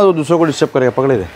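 usual speaking voice

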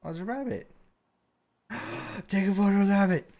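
high, loud excited squeal; thrilled, high-pitched delight. Real, not acted. Spots a rabbit in game.

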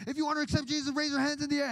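high, urgent preacher's call; altar-call pastor. Retold or imitated. Pastor inviting people forward after the sermon, high mock altar call.

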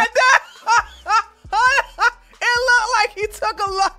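cracking up, can barely get the words out; giggling through an apology; laughing hard. Real, not acted. Vlogger cracking up at the frozen senator clip, laughing. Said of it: "I'm sorry, hold on".

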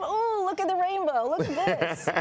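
put-on high, bright exclamation for a child; excited to her son. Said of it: "So now I say to him".

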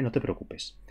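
soft whispered reassurance. Real, not acted. Streamer calming a stressed viewer, in a whisper. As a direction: whisper it comfortingly. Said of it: "gentle reassurance to viewers".

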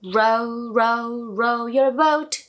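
slow, careful "Row, row, row your boat"; practice model. Shown as a demonstration. Teacher leading practice. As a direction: model it for the kids to repeat. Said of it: "같이 연습해 볼게요".

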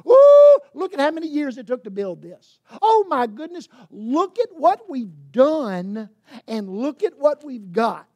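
loud, high gasp of wonder, then gushing; awed disciples. Acted. Disciples boasting to Jesus about the temple, dazzled. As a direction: brag about what was built, dazzled.

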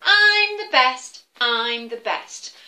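chanting I'm the best, proud and slow; boastful eagle. Acted. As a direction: crow it out like a show-off eagle. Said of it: "Do that with me".

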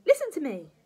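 loud, high granny order; forceful old battle-axe; bossy grandma. Acted. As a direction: demand attention imperiously.

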